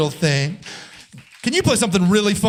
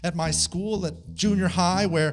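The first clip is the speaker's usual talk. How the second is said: rhythmic spoken-word delivery over a beat; performance poetry; 4 semitones lower, 4 dB quieter than usual. Acted. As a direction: perform it like a slam poem, deadpan and steady. Spoken-word poem over music.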